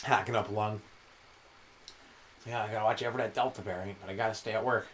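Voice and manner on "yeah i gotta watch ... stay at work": low, soft, worn-out mumble; lightly voiced sick chef. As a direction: grumble it like you're too sick to care.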